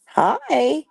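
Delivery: high sing-song hi to the cat; pet greeting. Real, not acted. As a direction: lift it way up for the kitty. Greeting the kitty.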